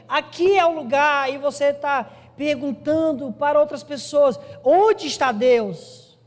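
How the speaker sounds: high, loud, impassioned sermon climax; full-force preaching. Real, not acted. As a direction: call the congregation with urgent, drawn-out emphasis. (pregação inflamada, voz alta e forte)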